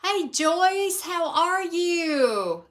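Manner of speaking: high, loud, slow sing-song hello; excited hello to Joyce; excited greeting. Real, not acted. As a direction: greet a friend who just arrived, delighted. Greeting a viewer joining the live.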